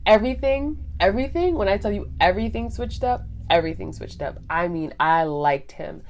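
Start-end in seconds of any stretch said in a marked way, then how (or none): 0.1-3.2 rising excitement. Real, not acted. Telling viewers how her life changed, excited high climb. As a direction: pitch jumping high and loud on each repeat.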